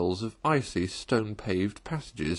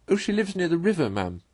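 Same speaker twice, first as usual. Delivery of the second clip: lightly voiced washerwoman, higher and quick; Toad as washerwoman; 8 semitones higher than usual. Toad in disguise answers the bargewoman, lightly voiced and quick. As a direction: answer brightly and in a hurry. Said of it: "replied Toad".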